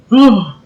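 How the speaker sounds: high, sudden mock swoon; hammed-up gasp of delight. Lovestruck at her grin, mock swooning.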